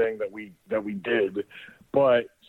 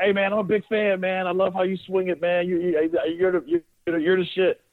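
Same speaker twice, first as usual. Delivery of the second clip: high hyped-up yell at a star, half-joking; yelled fan shout; 8 semitones higher than usual. Retold or imitated. Teammate yelling to Acuna from the suite, high excited fan yell. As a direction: call out to the slugger like an excited fan, pitch way up. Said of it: "yells out to him".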